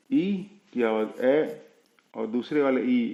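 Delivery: ordinary delivery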